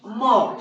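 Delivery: M read as "म", not "em"; letter sound for M. Shown as a demonstration. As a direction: say "म" as one open "maw" syllable. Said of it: "आ एम को".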